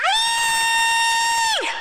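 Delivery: very high, drawn-out yell; long high-pitched fighting shout; shouted battle cry. Acted. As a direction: let out a piercing child's war cry. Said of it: "and shout, 'Aye!'".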